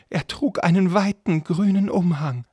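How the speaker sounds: high, loud, squeaky answer; anxious servant. Acted. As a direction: answer nervously in a put-on high voice. Frightened servant answers questioning. (ängstlicher Diener mit hoher Stimme)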